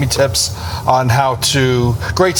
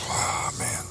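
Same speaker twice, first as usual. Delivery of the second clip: anxious under-breath sigh; 14 dB quieter than usual. Real, not acted. Anxious before doctor visit.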